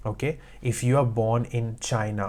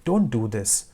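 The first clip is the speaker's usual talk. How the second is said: ordinary delivery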